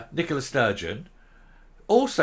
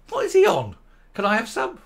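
incredulous; 8 semitones higher, 3 dB louder than usual. Real, not acted. Mocking disbelief at a politician.

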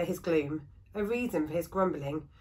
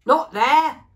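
much louder and higher furious shout; bellow; 7 semitones higher, 13 dB louder than usual. Acted. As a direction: roar at her to stop. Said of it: "bellowed the woodcarver".

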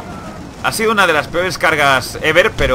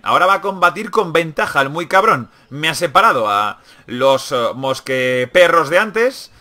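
normal talking voice

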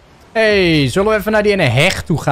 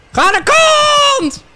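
very high, drawn-out scream; long high gamer scream; 5 dB louder, much slower than usual. Real, not acted. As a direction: scream it, stretching the last word. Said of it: "Lotte vraagt of je niet zoveel schreeuwt".

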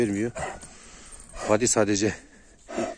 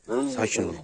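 usual speaking voice

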